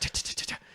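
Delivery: soft unvoiced ta-ta-ta like pellets firing; mock airsoft rattle; airsoft gun noise. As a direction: spit out little gun shots with the tongue. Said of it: "he'd be like".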